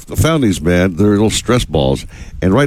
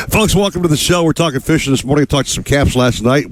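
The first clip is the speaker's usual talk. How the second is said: show host intro; 6 semitones higher than usual. Acted. Radio show opening.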